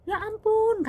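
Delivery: high, loud cry of dismay; shocked exclamation. Retold or imitated. Boss shown her maid's scrapes, shocked exclamation. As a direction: imitate a boss aghast at wounds.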